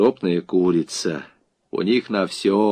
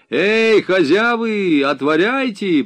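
high, drawn-out call from the street; shouted order; 9 semitones higher, 4 dB louder, slower than usual. A narrator's reading. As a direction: holler to those behind the gate. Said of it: "приказали с улицы". (громкий приказ с улицы)

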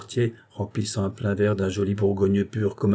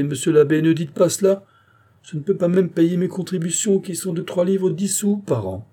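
higher, louder pleading protest; rising protest; 10 semitones higher, 4 dB louder than usual. A narrator's reading. Aunt Angélique protesting to the abbé, louder and higher.

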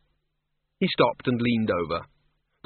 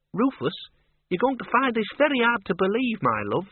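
high-pitched character voice; character breaking odd news; 10 semitones higher than usual. Acted. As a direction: pitch it high, addressing someone close. Character breaking hard-to-believe news.

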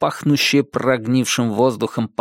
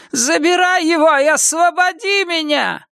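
deafening scream. Acted. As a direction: scream it up to the sky. Witch shouts her demand.

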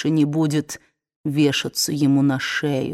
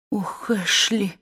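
despairing cry; 3 semitones higher, slower than usual. A narrator's reading. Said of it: "в отчаянии воскликнула она".

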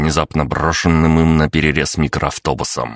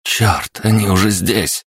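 cursing; 6 semitones higher than usual. A narrator's reading. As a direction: swear in alarm. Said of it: "выругался Сеня".

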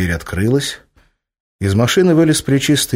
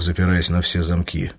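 usual speaking voice

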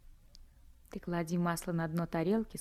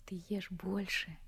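slow, soft whispered urging; tender whisper; 5 dB quieter than usual. Acted. Anka tenderly whispers to Vanyushka. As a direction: coax him gently to eat more.

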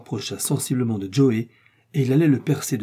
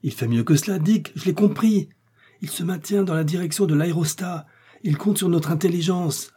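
high-pitched, eager explanation; excited realisation; 7 semitones higher than usual. A narrator's reading. Doctor telling Dick he understands Joey's plan, excited realisation. Grasps the friend's plan, urgent.

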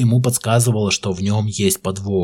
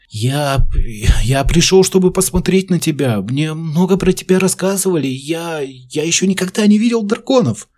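put-on high, timid boy's voice; a scared boy trying to sound brave; nervous boy; 8 semitones higher, 3 dB louder than usual. A narrator's reading. Frightened boy speaks to dragon.

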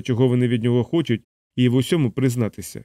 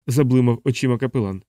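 plain reading voice

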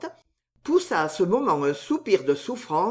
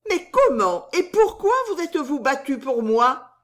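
voix aiguë de jeune servante; interroger, aiguë et vive; soubrette curieuse; 7 semitones higher, 4 dB louder than usual. A narrator's reading. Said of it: "demanda-t-elle". (Susan questionne Bernice, voix aiguë)

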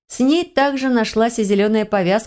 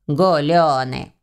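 slow, drawn-out scornful word; contemptuous; 3 semitones lower, much slower than usual. A narrator's reading. Envious house spirit to the revellers, drawn-out contempt. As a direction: stretch the word out with disdain. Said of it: "Последнее слово было высказано с презрением".

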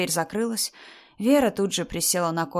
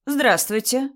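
brisk greeting, pitched higher; energetic greeting; 6 semitones higher, 3 dB louder, slower than usual. A narrator's reading. Mother-in-law greeting an unknown man, energetic. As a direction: greet a stranger with forceful energy. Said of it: "энергично поздоровалась она с кем-то".

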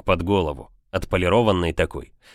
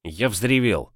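plain reading voice

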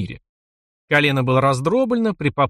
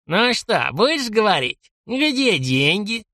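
put-on slow, higher nasty old geezer; old man interrogating; 7 semitones higher, slower than usual. A narrator's reading. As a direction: press him like a sly old man.

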